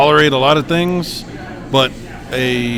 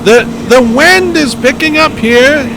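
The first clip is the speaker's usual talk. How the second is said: shouting over roaring wind, high and loud; yelling over hurricane winds; 10 semitones higher, 7 dB louder than usual. Pretend. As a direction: storm reporter yelling into the gale. Mock live storm reporter.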